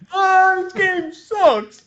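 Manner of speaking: high, drawn-out mock whine; hammed-up whiny kid voice; disappointed kid. Acting the disappointed child.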